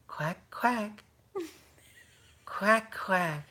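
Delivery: high, spaced-out quacking; imitated duck quacks. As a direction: quack like the ride lady playing duck. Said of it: "what sound does the duck make?".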